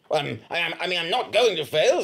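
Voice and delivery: normal talking voice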